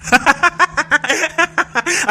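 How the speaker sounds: long high cackle; knowing, teasing laugh. Acted. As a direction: laugh out high at catching her out. Laughs at the exposed secret. (खिलखिला के हँसी)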